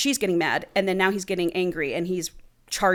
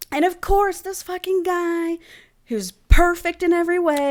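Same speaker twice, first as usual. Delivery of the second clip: swooning, much higher, over the perfect man; gushing; 10 semitones higher, 3 dB louder than usual. Real, not acted. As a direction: gush like a smitten fan.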